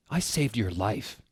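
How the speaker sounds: ordinary delivery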